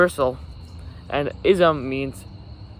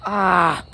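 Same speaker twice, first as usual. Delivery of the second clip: frustrated cry; 4 semitones higher, 5 dB louder than usual. Real, not acted. Page rips, frustrated cry.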